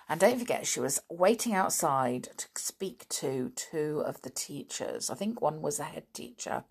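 usual speaking voice

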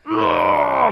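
loud throaty vomiting noise; loud mock retch; imitated retching. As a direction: mimic a big heave of puke. Imitates himself throwing up.

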